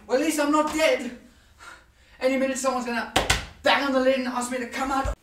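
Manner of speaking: animated arguing with oneself; musing monologue character. Acted. Debating life in a box, building in animation.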